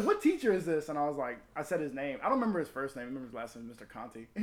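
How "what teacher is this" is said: higher, louder eager question; excited demand. Retold or imitated. Friend demanding the teacher's name, high excited voice. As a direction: press for the name, worked up.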